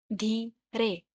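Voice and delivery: "धीरे" split as धी, रे; syllable by syllable. Shown as a demonstration. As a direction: say "धीरे" in two separate beats. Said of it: "टू syllables". ("धीरे" दो टुकड़ों में, धी-रे)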